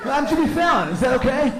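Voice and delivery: very high, loud, gushing host; shrieky, fawning delight, hammed up; Jimmy Fallon. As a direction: gush like an overexcited people-pleasing talk show host. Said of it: "They acted like Jimmy Fallon would have".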